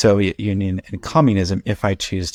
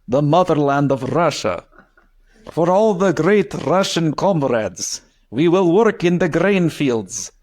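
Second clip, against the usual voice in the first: put-on thick Russian accent; 7 semitones higher than usual. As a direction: declaim like a Soviet patriot in a thick Russian accent. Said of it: "with a thick Russian accent".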